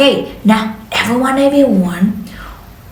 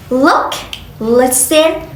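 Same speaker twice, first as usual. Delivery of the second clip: ordinary talk, nothing marked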